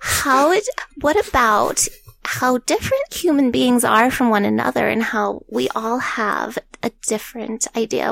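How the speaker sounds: lightly voiced old granny; a hint of a quavery grandma; old lady voice. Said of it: "Can you do that in an old lady voice?".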